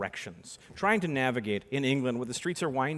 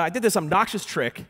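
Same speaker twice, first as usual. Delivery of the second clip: ordinary delivery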